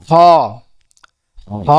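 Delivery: হ as a rasped Arabic kh from the end of the throat; back-of-throat kho. Shown as a demonstration. Teacher shows the letter kho as হ, rasped from the throat's end. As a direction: scrape the start of হ, then a held "o". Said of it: "এই কণ্ঠনালীর শেষ থেকে".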